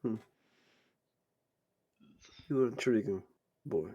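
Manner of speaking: soft, unhurried appraisal; understated menacing curiosity; intrigued game villain. Acted. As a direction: size someone up quietly, with long pauses. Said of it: "and he just goes".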